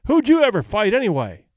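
pool-room owner demanding the fighter's record. A narrator's reading. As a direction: challenge his record with scorn. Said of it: "Michael Kelly demanded".

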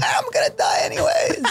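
high carefree shout; nothing to lose, shouting. Pretend. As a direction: yell it out, high and reckless. Carefree death row bravado.

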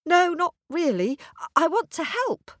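put-on high, slightly nervous girl; young girl voice. A narrator's reading. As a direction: brave but a little unsure girl. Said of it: "asked the bear".

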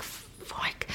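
breathless whispered fuck; whispered curse. Retold or imitated. Rookie remembering the missed anniversary, whispered curse. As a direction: mutter it under your breath.

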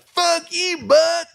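very high, strained furious yell; angry heckler. Retold or imitated. Angry fan cursing the announcer, high strained yell. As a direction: scream abuse like an enraged fan.

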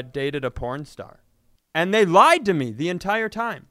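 usual speaking voice

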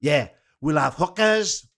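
slow, rising Valleys Welsh promise; Pontypridd-Merthyr accent. Retold or imitated. Fan promises a wild road trip, in slow Pontypridd accent. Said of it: "I'll do him as Pontypridd".

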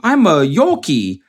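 high, slow mock Yorkie voice; high talking-dog caption voice. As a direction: draw it out as a high pet voice. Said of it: "But it was like".

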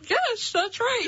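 slow, through a full mouth. Real, not acted. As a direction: cheeks packed, words muffled. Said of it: "That was clear as day".

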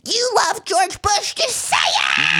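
high, slow, shouting mom voice; hammed-up mother yelling accusation; an imitated mom yelling at her son; mock mom outburst. Mom voiced in retold scene.

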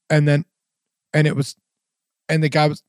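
sentence starts chopped off by dead gaps; broken fragments, silence between; imitating mic cutting out. Shown as a demonstration. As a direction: mimic speech dropping out mid-phrase. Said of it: "it was doing the same exact thing".

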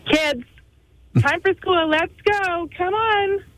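put-on mom waking kids. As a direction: call the kids up for school. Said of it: "Wake them up".

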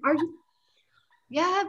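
hushed whisper. Retold or imitated. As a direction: whisper it so others can't hear. Whispered exchange at desk.

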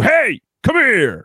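drawn-out high holler; bad-boy call. Man in brass knuckles calling a woman over, drawn-out holler. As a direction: summon them over like a tough guy. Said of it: "and you go".

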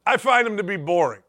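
ordinary talk

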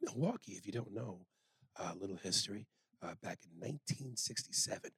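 hushed late-night jazz DJ; jazz radio voice. Pretend. Co-host giving mock jazz history on air, jazz radio voice. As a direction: softly murmur it like a jazz-history broadcast. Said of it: "Your jazz radio voice".

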